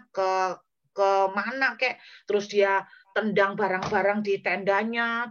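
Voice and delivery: ordinary talk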